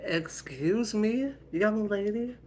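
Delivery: high, loud, drawn-out challenge; offended mom demanding an explanation; indignant mother. Acted. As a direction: scold a cheeky child, rising sharply. Mother challenges defiant daughter.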